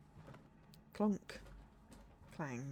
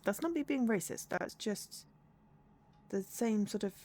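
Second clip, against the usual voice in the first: normal talking voice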